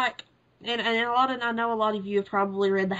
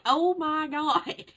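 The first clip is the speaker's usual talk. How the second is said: stunned gasp; 7 semitones higher, much slower than usual. Pretend. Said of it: "being like".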